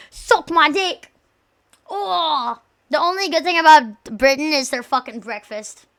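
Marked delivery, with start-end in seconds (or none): 0.1-1.1 mock British taunt.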